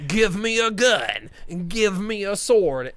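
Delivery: slow, loud, hammed-up hero order; mock heroic command. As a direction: bark it like a hammy hero. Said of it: "And he goes".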